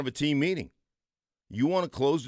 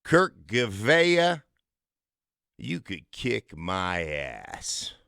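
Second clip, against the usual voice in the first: slow pointed callout; 6 semitones lower, much slower than usual. Retold or imitated. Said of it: "he goes".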